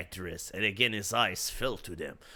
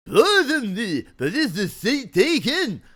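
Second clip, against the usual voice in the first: suave stranger voice; 11 semitones higher, 10 dB louder than usual. Acted. [name] interrupts in voice.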